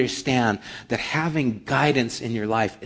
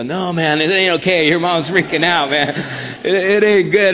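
louder, higher urgent protest; alarmed protest; 7 semitones higher, 9 dB louder than usual. Retold or imitated. Protesting to Dave about his freaking-out mom, loud. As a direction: insist it is not okay, urgently. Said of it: "You go".

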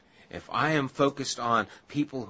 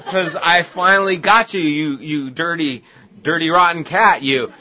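mock fury at cat; 5 semitones higher, 14 dB louder than usual. Yelling at the cat.

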